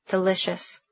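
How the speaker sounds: hushed, breathy savouring; dreamy whisper. A narrator's reading. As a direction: linger on delicious, almost whispered. She savours his dark eyes.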